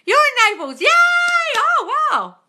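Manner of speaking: light up at each new name; excited greeting. Real, not acted. Host excitedly greeting viewers from Naples, high and bright.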